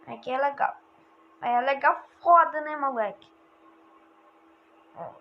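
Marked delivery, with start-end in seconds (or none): none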